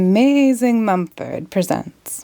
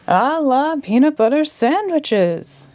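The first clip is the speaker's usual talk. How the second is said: high, drawn-out magic shriek; a magician's shrieked incantation; 7 semitones higher, slower than usual. A narrator's reading. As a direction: shriek the spell out. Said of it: "he shrieked".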